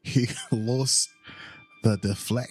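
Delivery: put-on botched Scottish accent, rising in pitch. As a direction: keep stumbling at a bad Scottish accent. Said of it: "than my Scottish accent".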